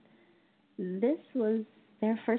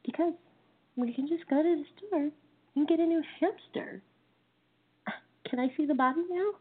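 higher, fast, bright-eyed child's voice, lightly voiced; cheerful little girl; 10 semitones higher, faster than usual. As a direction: chirp it like a cheery six-year-old. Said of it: "And she said".

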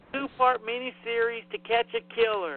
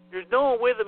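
ordinary talk, nothing marked

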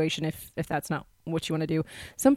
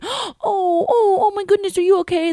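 high, loud gasping alarm; hammed-up fussing over a fallen child; panicked parent; 7 dB louder than usual. Acting parent overreacting to child's fall.

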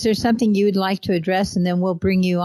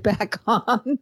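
laughing in disbelief; 2 semitones higher than usual. Real, not acted. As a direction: let a laugh break in at the end. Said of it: "I mean, I'm laughing".

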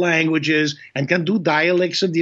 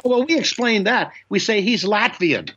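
director's excuse; 6 semitones higher than usual. Retold or imitated. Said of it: "He said".

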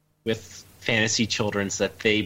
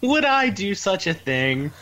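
high-pitched, teasing feigned innocence; mock innocence; 9 semitones higher than usual. Real, not acted. Cohost teasing the author about the author's forgetfulness, mock-innocent. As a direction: play innocent while obviously meaning yes. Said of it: "tell from your tone you would".

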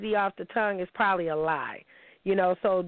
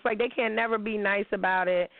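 ordinary talk

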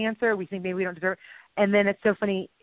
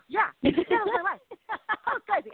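laughing; 11 semitones higher than usual. Real, not acted. Laughing at embarrassing memory.

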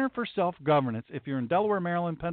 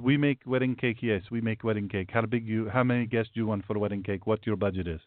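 put-on lower, broken-English baker voice with a foreign accent; a lightly accented baker taking an order; 6 semitones lower than usual. Baker answers the customer, lower broken-English accent. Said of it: "the guy goes".

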